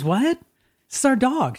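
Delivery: high mock protest; lightly played outraged mom; indignant neighbor. Neighbor lady protesting the dog is hers, mild mock outrage. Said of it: "And she goes".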